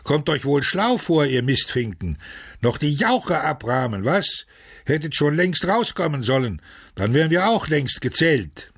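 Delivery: high-pitched scolding of latecomers; angry jeering call. A narrator's reading. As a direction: heckle them crossly from the front rows. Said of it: "riefen ihnen welche aus den ersten Reihen entgegen".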